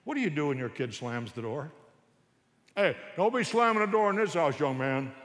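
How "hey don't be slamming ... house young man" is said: loud, higher scolding of the son; authoritarian parent yell. Retold or imitated. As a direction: bark at the kid for slamming the door. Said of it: "That's the authoritarian".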